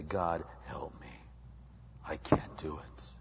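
soft, low prayer of surrender; humble plea. Acted. Sinner bows before God, humble quiet plea. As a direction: let the voice sink in helpless humility.

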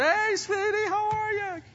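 put-on high, sweet old-lady greeting; grandma voice. Risen grandma cruising down the aisle greeting churchgoers. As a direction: chirp it like a grandma waving hello.

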